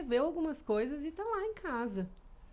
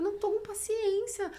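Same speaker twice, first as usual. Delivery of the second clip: impatient retort; 11 semitones higher than usual. Retold or imitated. Retelling an exchange with the psychologist.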